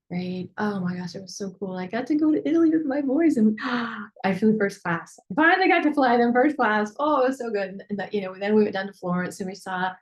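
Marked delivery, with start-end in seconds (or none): 0.6-7.7 high, loud gushing about a past trip; excited as if done. Shown as a demonstration. Demonstrating speaking as if the Italy trip already happened, gushing excitedly. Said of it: "speak as if it was done six months ago".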